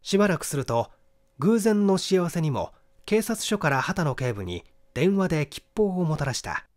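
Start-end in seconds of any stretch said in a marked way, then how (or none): none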